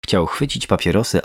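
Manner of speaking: plain reading voice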